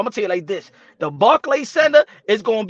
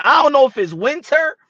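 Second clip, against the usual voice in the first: ordinary talk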